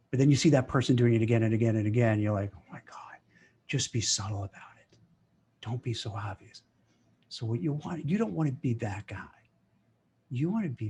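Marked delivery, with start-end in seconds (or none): 2.6-6.6 hushed, unvoiced aside of disbelief; exasperated whisper. Watching a coworker job-hunt openly, exasperated whisper. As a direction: whisper it, half to yourself, exasperated. Inner reaction to obvious coworker.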